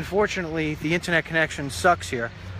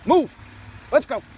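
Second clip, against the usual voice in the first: loud call across the pond to Bill; shouting at the duck to move, loud and high; 7 semitones higher, 3 dB louder than usual. Real, not acted. Calling to ducks across pond.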